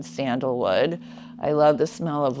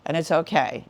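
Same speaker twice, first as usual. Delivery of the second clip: ordinary talk, nothing marked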